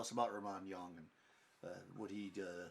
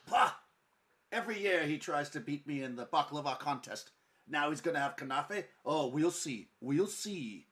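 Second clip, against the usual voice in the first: loud, higher, jealous huffing; indignant scoff; 5 semitones higher, 9 dB louder than usual. Acted. Baker fuming about Khalif's kanafe, loud and indignant.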